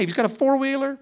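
four-wheeler hit high and loud in disbelief; amazed. Retold or imitated. Pastor telling church about neighbor boy, mock amazed. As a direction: marvel that a kid owns a four-wheeler. Bragging about a rich five-year-old.

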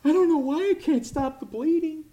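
a bleeding man in helpless panic; panicked victim. Acted. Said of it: "And they say".

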